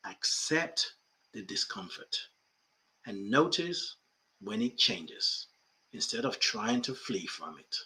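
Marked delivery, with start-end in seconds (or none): none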